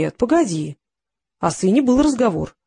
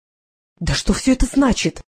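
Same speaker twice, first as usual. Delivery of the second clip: a lady's indignant exclaim, higher and slow; mild outrage from a startled lady; 4 semitones higher than usual. A narrator's reading. Administrator protesting to the detective, mildly indignant. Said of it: "возмущённо воскликнула дама".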